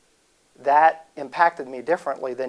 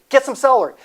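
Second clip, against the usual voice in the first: excited; 10 semitones higher, 7 dB louder than usual. Shown as a demonstration. Hyping up the celery table.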